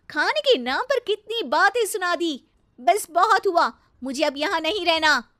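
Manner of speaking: loud, high-pitched petulant outburst; huffy anger. Acted. Wife compares in-laws with her brother's home, huffy. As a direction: storm off in a huff. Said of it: "नैना गुस्से में तुनकते हुए".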